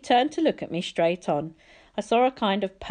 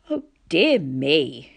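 slow, hammed-up mock surprise; drawn out like he never saw it coming; feigned surprise; 2 semitones lower, 5 dB louder, much slower than usual. A narrator's reading. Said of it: "feigned surprise".

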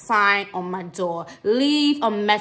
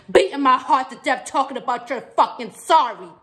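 louder, higher burst of anger; angry outburst; 4 semitones higher, 5 dB louder than usual. Acted. Fed-up partner lashing out over endless apologies, angry. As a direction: throw it at them, furious and loud.

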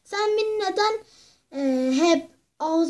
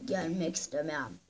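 grumpy creature voice; 14 semitones lower, 14 dB quieter than usual. Acted. Voicing reluctant animal again.

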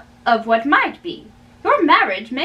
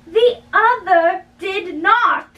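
tearful; 6 semitones higher than usual. Acted. Romeo tearful about Rosaline.

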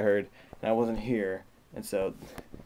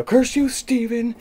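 mock dramatic curse; 11 semitones higher, 5 dB louder, slower than usual.

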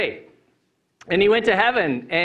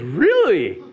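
disbelief; 2 semitones higher, 5 dB louder than usual. Acted. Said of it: "The guy goes".

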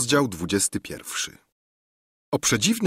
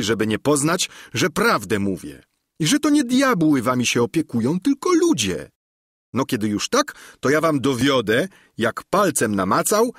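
a lightly voiced peasant squire, a touch higher; plain-spoken servant arguing with his master, lightly played; Sancho Pansa; 6 semitones higher, 5 dB louder than usual. A narrator's reading. Sancho explaining to his master, mildly raised and earnest.

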